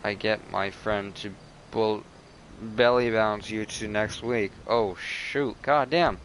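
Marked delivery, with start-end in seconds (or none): none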